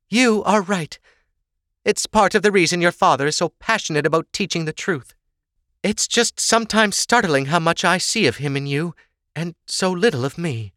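high-pitched motherly admission; confiding mother. Acted. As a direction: concede the point to your daughter, pitched high. Sorsha confides in her daughter.

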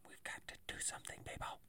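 urgent whisper. Real, not acted. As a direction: plead in a hushed whisper. Pleading about pandemic cases.

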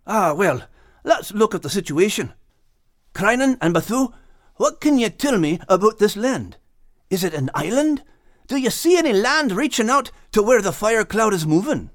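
put-on earnest young Scot explaining; Max's voice. A narrator's reading. Max telling old friends about the reeds, lightly Scottish.

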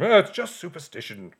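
dismissive Brit. Retold or imitated. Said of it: "Lawrence who tells him".

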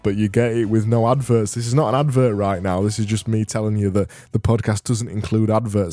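announcer-style plug; promo read. Acted. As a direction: sell the ad-free version. Plugs paid version over music.

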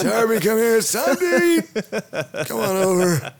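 put-on slow, high old-timer coaxing a pet; old man calling dog.

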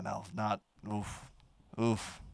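ordinary talk, nothing marked

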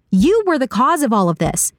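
high-pitched, upset blame; accusing high character voice without shouting; heated accusation. A narrator's reading. Saga accuses Esper in quarrel.

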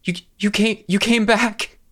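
high, teary, breaking voice; choked-up relief at being found; tearful disbelief. Acted. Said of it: "She's been crying".

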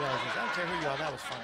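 quoting a friend through laughter; laughing quote. Retold or imitated. Host quotes Larry's verdict on the joke while laughing. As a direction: chuckle the words out. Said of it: "That's what Larry Cable says".

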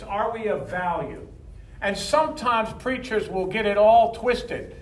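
ordinary delivery

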